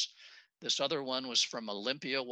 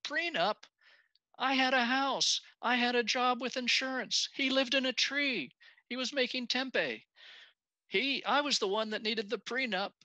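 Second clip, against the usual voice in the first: high-pitched mock indignant wife; scoffing; 13 semitones higher, 4 dB louder than usual. Wife scoffing when asked about a prenup, high mock indignation. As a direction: scoff in a much higher imitated voice. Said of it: "she scoffs and she goes".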